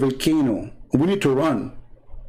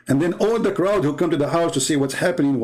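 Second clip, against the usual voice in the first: usual speaking voice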